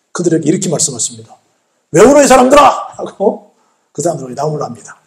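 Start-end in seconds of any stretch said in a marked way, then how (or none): 1.9-2.9 loud, raised-pitch scolding; acted rebuke. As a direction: act out scolding the mourners loudly. Jesus rebukes the wailing mourners. (꾸짖듯 큰 소리로)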